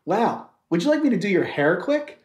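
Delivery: ordinary delivery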